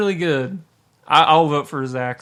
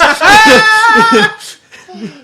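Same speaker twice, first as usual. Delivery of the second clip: big high whooping laugh; loud burst of laughter; 12 dB louder than usual. Real, not acted. Friends ranking bad performances, a big loud laugh. Laughing at King Koopa pick.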